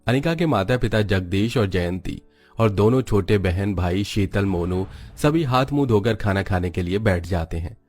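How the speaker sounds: usual speaking voice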